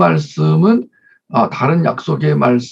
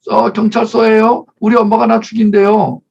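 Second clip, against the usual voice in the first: put-on tattling child; 8 semitones higher, 3 dB louder than usual. Child phoning police on mother.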